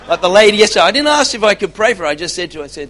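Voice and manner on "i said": ordinary talk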